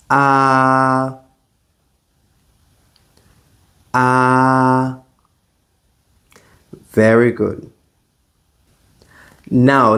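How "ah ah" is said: "Ah" with mouth wide open, relaxed; Mandarin a vowel. Shown as a demonstration. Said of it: "Let's try it again".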